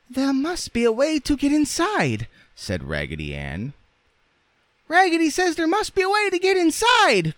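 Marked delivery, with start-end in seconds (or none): 0.1-2.3 very high, squeaky rag doll; Raggedy Ann's voice. A narrator's reading. Rag doll thinking aloud to the others, squeaky. 4.9-7.4 loud, extremely high squeaky cry; dolls crying out. A narrator's reading. Dolls echoing their leader together, crying out. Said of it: "cried all the dolls".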